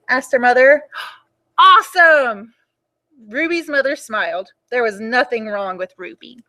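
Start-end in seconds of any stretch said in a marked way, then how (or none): 1.2-2.5 1 excited. Acted. Ruby's delighted reaction.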